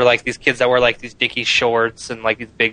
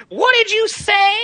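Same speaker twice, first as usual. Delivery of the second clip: much higher, slow appalled voice; imitating the shocked teacher; slower than usual. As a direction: play the stunned teacher. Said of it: "the teacher was like".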